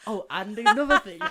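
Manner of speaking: laughing mock quote. Mocking their long goodbyes.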